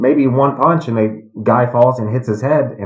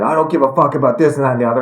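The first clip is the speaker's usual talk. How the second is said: fast, higher mock rant; imitating an angry guy, rushed; retold yelling; 5 semitones higher, much faster than usual. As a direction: rattle off an angry stranger's tirade. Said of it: "he starts yelling at me".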